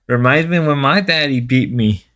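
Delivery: usual speaking voice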